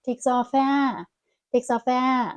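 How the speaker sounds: "Takes off, a" chained as one; linked words. Shown as a demonstration. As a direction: link "Takes off, a" with no breaks. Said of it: "також склеїмо".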